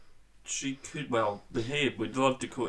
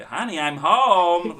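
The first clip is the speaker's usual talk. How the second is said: slow, much louder and higher sing-song call; 6 semitones higher, 10 dB louder, much slower than usual. Pretend. [name] arriving home to Patti, mock calling out loud. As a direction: boom it through the house like a sitcom spouse.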